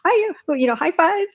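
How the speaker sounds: high, excited retold celebration; high-pitched, gleeful quoting of the team; ecstatic. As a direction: re-enact an ecstatic cheer, pitch way up. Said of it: "like, ecstatic. We were like".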